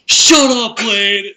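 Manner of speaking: high, very loud imitation yell. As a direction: belt it out as the classmate did. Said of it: "David yelled".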